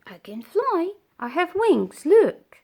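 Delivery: high, loud proud boasting; boastful bee. Acted. Bumblebee proudly showing caterpillar its wings, loud boast. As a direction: show off your wings.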